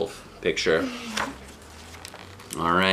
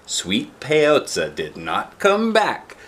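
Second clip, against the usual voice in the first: slow, higher, drawn-out refrain; slow suspense; 5 semitones higher, much slower than usual. A narrator's reading. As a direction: stretch it out with suspense.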